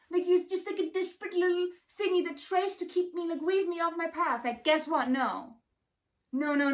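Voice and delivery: high, defiant voice calling out the dark energy; defiant callout. Retold or imitated. Facing down the dark energies, high and scornful. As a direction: stare it down and dismiss it. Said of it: "be like".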